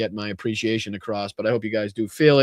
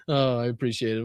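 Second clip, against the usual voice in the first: ordinary talk, nothing marked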